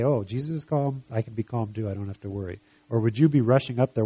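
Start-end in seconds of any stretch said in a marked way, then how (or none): 0.0-2.6 softer, reassured voice; calm. Acted. Believer watching Jesus calm in the storm, soft and calm. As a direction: let it settle down softly. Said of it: "where you could see Jesus calm".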